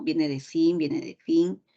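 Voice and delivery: ordinary talk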